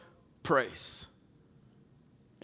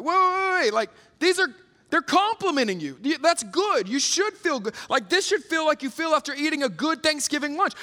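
excited; 6 semitones higher, 3 dB louder than usual. Retold or imitated. Retells his own excited younger self.

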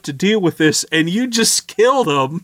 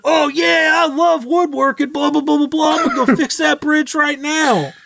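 hammed-up enthusiastic NPC; mock eager woodcutter; 10 semitones higher than usual. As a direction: gush like a bridge-obsessed fan. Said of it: "He's like".

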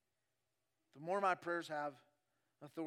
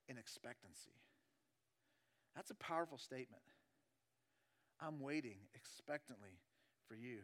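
soft, low voice with weighty pauses; hushed preaching; 7 semitones lower, 9 dB quieter than usual. Acted. Preacher voicing David waiting expectantly, hushed and low. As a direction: drop right down and let it settle.

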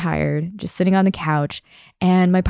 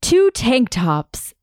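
slow, earnest and higher, each word laid out; mock earnest partner; 6 semitones higher, much slower than usual. Partner solemnly reads his packing list, slow earnest voice. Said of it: "earnestly says".

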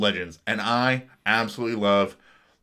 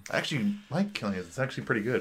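softer, musing aside; quoting own thought; 8 dB quieter, faster than usual. Retold or imitated. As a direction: voice a private thought softly. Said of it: "and I'm like".

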